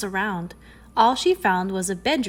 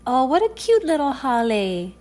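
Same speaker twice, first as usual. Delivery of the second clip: put-on higher-pitched little-girl exclamation; delighted exclamation; 4 semitones higher, 4 dB louder than usual. A narrator's reading. Girl spotting the mongoose house, delighted exclamation. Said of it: "exclaimed Vili Vaikula".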